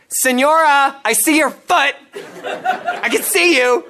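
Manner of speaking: high, loud, teasing menace, slowing on the end; menacing missionary. Retold or imitated. Young missionary calling at the hiding woman's door, mock menacing. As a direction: loom over her like a playful stalker. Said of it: "just being so menacing".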